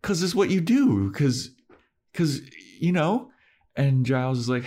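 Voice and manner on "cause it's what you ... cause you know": high, halting retold excuse; floundering plea. Spike answers Giles's challenge, floundering plea. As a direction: imitate someone fishing for a reason.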